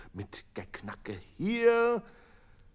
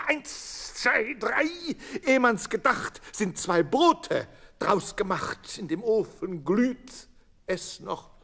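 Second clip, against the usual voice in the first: lively rhyme; 11 semitones higher, 7 dB louder than usual. A narrator's reading. Baker's speedy bread-making, performed.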